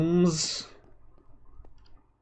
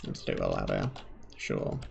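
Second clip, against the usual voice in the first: much lower, sulky put-upon grumble; grudging grumble; 9 dB quieter than usual. Pretend. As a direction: grumble it like a reluctant sulker. Grudgingly giving in on ladder.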